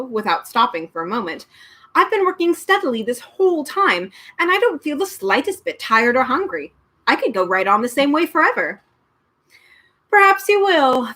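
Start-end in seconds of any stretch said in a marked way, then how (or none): 2.0-8.8 put-on higher, eager boy's voice; a keen young boy, lightly voiced; young boy Milo. Milo telling the man, eager boyish voice. 10.1-11.1 with a yawn. A narrator's reading. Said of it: "agreed with a yawn".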